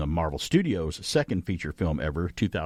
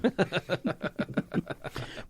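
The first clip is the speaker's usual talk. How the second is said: breathy laugh; 9 semitones higher, 4 dB quieter than usual. Real, not acted. Laughing after his quote.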